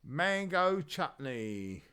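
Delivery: Mango Chutney stretched out very slowly; drawn-out. Pretend. Reading a patron's name, slow drawn-out. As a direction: draw out Mango Chutney syllable by syllable. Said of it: "What happened there?".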